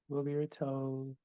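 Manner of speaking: usual speaking voice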